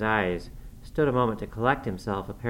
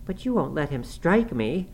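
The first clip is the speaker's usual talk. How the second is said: higher-pitched, pleading question; crying out; 6 semitones higher than usual. A narrator's reading. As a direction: appeal to him for protection. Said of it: "she cried".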